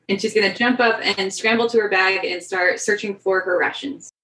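usual speaking voice

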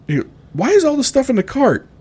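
a wife demanding answers from her husband; mock confused wife. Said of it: "she looks at him and goes".

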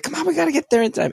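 very high, fast, eager urging; excited rush. Retold or imitated. Dad rushing his son to the window, excited. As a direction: race him to the window.

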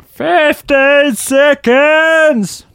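high, slow coach shout; gym instructor impression. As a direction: bellow it like a trainer. Instructor call.

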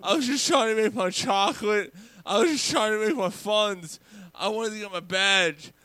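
high, pleading mock child voice; apologetic little kid. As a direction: beg forgiveness as a hammed-up little boy. Acting the kid apologizing to old man.

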